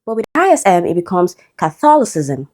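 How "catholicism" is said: "Catholicism" stressed on THOL; second-syllable stress. Shown as a demonstration. Teacher showing the exception, second-syllable stress. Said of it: "stressed on the second syllable".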